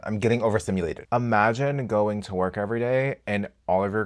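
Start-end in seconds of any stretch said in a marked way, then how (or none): 0.0-1.1 quick, full-voiced repeat; fast repeat. Shown as a demonstration. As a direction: rattle it off.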